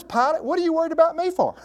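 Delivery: high-pitched, colloquial challenge; incredulous questioning. Acted. Preacher playing Jesus answering Pilate, incredulous. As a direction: ask it high, puzzled at the worry.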